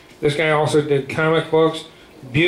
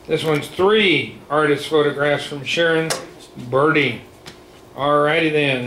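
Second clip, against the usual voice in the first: ordinary talk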